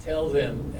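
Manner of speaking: ordinary delivery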